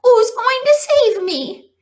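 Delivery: high, loud pleading question; eager hope. A narrator's reading. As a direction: reach for hope desperately. Wilbur begging for hope.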